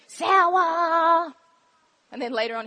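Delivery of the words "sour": loud, drawn-out mock child's shout; kids calling out. Teacher imitating kids on the bus, calling out long and loud. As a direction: hold the word long and loud. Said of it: "I hear them go".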